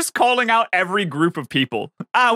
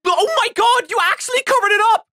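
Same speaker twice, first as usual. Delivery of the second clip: outraged; 12 semitones higher than usual. Real, not acted. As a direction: call out a betrayal in disbelief. Outraged at covered-up challenge.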